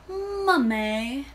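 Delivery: ordinary delivery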